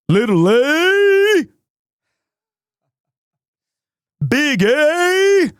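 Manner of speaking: put-on high, slow, drawn-out sing-song voice for a baby; a high, slow, playful voice for reading an ABC book to a baby son; silly reading voice. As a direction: stretch each letter out slowly to amuse the baby. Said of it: "And I did this voice".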